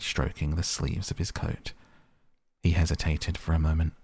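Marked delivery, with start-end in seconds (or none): none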